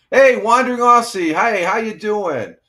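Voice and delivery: high, loud, bright hello called out; excited greeting. Real, not acted. Streamer greets a viewer arriving from Australia, excited and loud. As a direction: throw a big happy hello to a new arrival.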